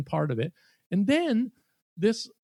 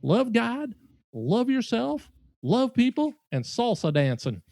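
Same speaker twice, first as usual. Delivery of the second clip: put-on Southern accent; 4 semitones higher than usual. Diner listing four things about faith, in a Southern accent. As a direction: preach a list like an earnest Southerner. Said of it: "in this Southern accent".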